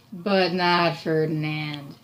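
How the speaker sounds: slow, weighty storybook line; slowed story emphasis; slow dramatic read. Acted. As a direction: draw it out for effect. Teacher reads the story line dramatically.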